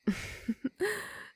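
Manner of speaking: high, breathy condescending laugh; soft mocking laugh. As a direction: laugh at his empty threat. Vampire mocks captive's defiance.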